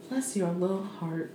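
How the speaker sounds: soft, slow mock sympathy; hushed, condescending sympathy; pitying bless-your-heart. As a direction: say it gently, as if pitying. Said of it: "definition of bless your heart".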